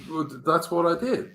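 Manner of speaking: usual speaking voice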